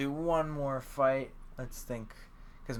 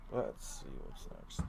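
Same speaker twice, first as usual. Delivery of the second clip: very soft, slow whisper; unhurried whispered aside to yourself; whispering to oneself; 11 semitones lower, 11 dB quieter, slower than usual. Real, not acted. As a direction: whisper it under your breath. Checking notes, muttering to himself.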